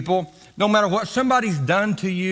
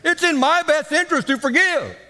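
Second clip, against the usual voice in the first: higher, laughing emphasis; rising fervor; 7 semitones higher, faster than usual. Shown as a demonstration. Preacher repeating the point to congregation, rising fervor. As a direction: build the line up with a laugh.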